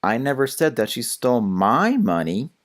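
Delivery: MY stressed with a rise. Shown as a demonstration. Tutor's last example of emphasis, stress on MY. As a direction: lift and hit the word my. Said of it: "And the last one".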